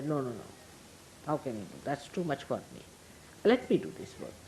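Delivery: a lightly voiced humble wife, soft and polite; demure refusal. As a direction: gently wave off his offer, hushed and modest. Wife politely refusing husband's help.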